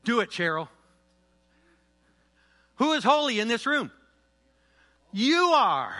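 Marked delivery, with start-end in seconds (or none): 2.8-5.9 calling out, high and loud; rally the room with a shout; loud preaching call. Real, not acted. Preacher calls out to congregation.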